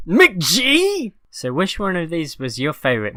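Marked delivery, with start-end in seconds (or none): 0.1-1.1 high, loud shouted call of a name; mock shout. Cohost yelling the director's name as a joke, mock shout. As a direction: bellow it out like a running gag.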